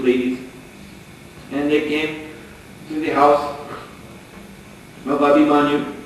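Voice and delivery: usual speaking voice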